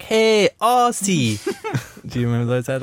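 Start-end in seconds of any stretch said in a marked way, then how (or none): 0.0-1.4 high, very slow drawn-out call of hey Aussie; retelling an ice cream ad, mimicking the Bali vendor's slow, high greeting. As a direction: stretch it out like a beach hawker calling to tourists. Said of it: "and the guy was like".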